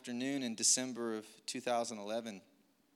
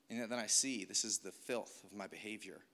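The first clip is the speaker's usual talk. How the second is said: ordinary delivery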